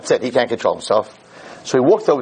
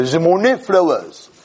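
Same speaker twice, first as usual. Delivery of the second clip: mock French accent; 5 semitones higher, slower than usual. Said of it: "For all those who don't speak French".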